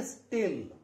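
स्टिल with a plain T after S; unaspirated T. Shown as a demonstration. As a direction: keep the T of स्टिल free of any puff. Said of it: "टी की sound तै ही आएगी".

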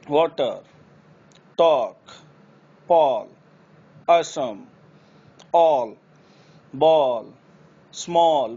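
वाटर, टॉक with a long rounded aw; open aw words. Shown as a demonstration. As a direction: round each aw fully. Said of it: "औ की निकलेगी". (औ वाले शब्द)

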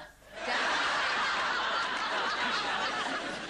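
long cackle. Real, not acted. Host laughs at toilet seat story.

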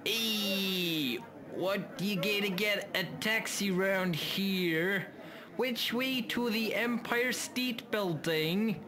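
high, slow put-on New York accent; fake New York accent. Fakes New York voices.

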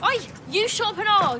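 high-pitched, heated telling-off; angry retort. Acted. Girl turning on another in a quarrel, angry retort. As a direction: round on them, voice jumping up.

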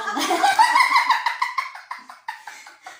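high loud burst of laughter. Real, not acted. Big laugh at party.

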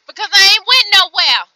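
loud, high, fed-up blurt; exasperated outburst. Real, not acted. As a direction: spit it out in frustration. Venting about stuck truck.

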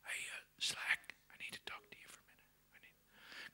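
barely audible whisper; still small voice. Acted. God taking Slack aside, in a faint whisper. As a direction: whisper it like a private word. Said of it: "'cause he talks in a still".

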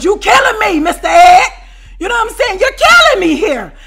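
high, loud laughing complaint; laughing exasperation. Real, not acted. Complaining to Mister Ed, high and loud with laughter. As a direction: howl it at him, laughing.

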